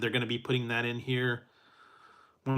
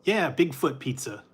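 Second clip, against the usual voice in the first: ordinary delivery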